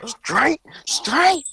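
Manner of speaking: squeaky fake outrage; high mock whine.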